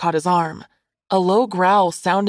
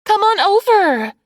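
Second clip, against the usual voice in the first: high, loud holler; beckoning call; 6 dB louder than usual. A narrator's reading. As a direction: call him over across the beach. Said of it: "She beckoned him".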